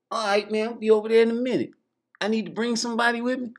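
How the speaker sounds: [name] eagerly accepts [name]'s party invite, fast and high; [name] voiced.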